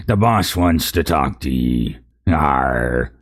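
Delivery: a hint of a gruff pirate brute; narc voice. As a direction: growl it like a thug guard. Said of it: "I gotta do my narc voice".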